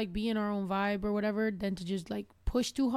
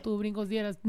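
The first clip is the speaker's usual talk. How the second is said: ordinary talk, nothing marked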